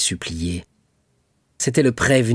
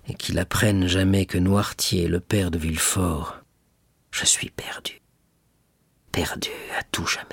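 soft murmur sinking into a whisper; murmured despair; 3 semitones lower, 4 dB quieter than usual. A narrator's reading. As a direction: whisper to yourself that all is lost. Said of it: "murmura-t-il". (murmure accablé qui finit en chuchotement)

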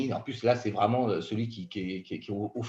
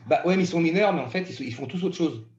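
choking on a drink; 6 semitones higher than usual. Real, not acted. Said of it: "je vais être travers".

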